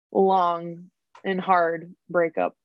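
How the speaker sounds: long drawn out slowly; stress on long. Real, not acted. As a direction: stretch the word long. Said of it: "Let's just emphasize long".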